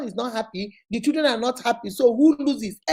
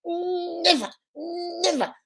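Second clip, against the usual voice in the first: taunting refusal; 8 semitones higher than usual. Retold or imitated. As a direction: drag it out to taunt the one begging. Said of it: "running around begging you".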